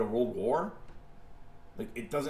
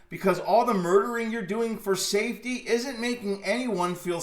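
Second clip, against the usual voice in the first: yelling, higher and louder, at the world's governments; furious call-out; 6 semitones higher, 6 dB louder than usual. Real, not acted. Ranting at the governments of the world, a loud furious shout. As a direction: shout them down, put your guns down.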